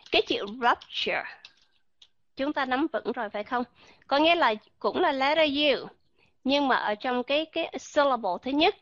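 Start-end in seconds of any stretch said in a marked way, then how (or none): none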